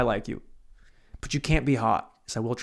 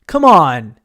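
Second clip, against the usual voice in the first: loud, drawn-out jokey yell; much louder playful appeal; mock shouted protest; 13 dB louder than usual. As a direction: belt out a kidding objection. Repeats the protest, escalating.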